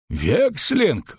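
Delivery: high-pitched, shocked exclamation; innkeeper's startled cry at moderate volume; cried out. A narrator's reading. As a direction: exclaim it in alarm, pitch jumping up. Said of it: "выкрикнул трактирщик".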